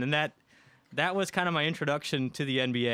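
usual speaking voice

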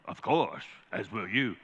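low, soft lion voice; imitating film lion. Preacher replaying the film exchange for the congregation, low lion impersonation. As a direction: answer as the lion, hushed and deep. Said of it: "And what does he say?".

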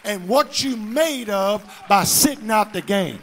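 full-force shouted preaching, rising. Acted. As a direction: drive it home to the congregation.

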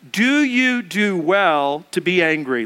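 slow, deliberate scripture reading; slow unison Bible reading; slow reading aloud. Shown as a demonstration. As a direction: read each word slowly with the congregation. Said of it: "let's read this together".

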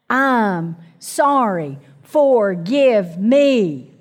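very slow, dragged-out words; forced apology. Shown as a demonstration. Preacher showing how a first apology comes out slowly. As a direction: squeeze it out reluctantly, word by word. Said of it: "we may even have to say it like that at the beginning".

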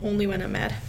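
usual speaking voice

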